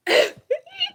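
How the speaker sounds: very high shrieking laugh; weird laugh. Real, not acted. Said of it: "I have the weirdest laugh".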